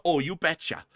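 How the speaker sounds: a playful Upper Midwestern accent impression; hammed-up Upper Midwestern twang. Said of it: "Midwesterners that are up near the lakes".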